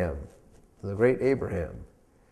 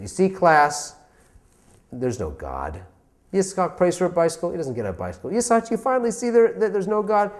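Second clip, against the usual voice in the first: mocking teacher; 6 semitones higher than usual. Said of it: "she wants to make fun of him".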